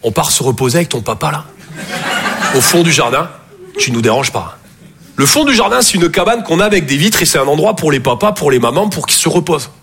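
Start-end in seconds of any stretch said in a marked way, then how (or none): 0.0-4.6 sweet mother. Retold or imitated. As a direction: coax the child to stay away. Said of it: "Et elle est là".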